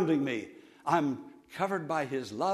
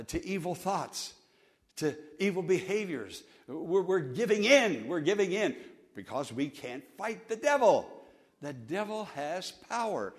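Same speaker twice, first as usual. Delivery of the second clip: normal talking voice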